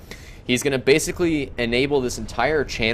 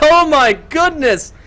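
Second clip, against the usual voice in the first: shrieking, far higher and much louder; ecstatic screaming; 8 semitones higher, 5 dB louder, slower than usual. Real, not acted. Caster to viewers as the commander survives, ecstatic screaming. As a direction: lose it completely at the miracle save. Still reeling after survival.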